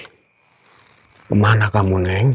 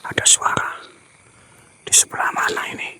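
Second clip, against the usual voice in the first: tense hushed whisper; 8 dB quieter, faster than usual. Real, not acted. Ghost hunter hearing a sound to his audience, tense whisper. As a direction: whisper as if listening hard in the dark.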